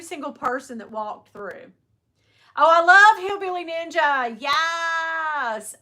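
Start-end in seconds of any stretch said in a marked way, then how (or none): 2.6-5.8 loud, high burst of love for the show; delighted loud exclamation; excited gush. Real, not acted. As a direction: gush like a fan. Excited fan gushing.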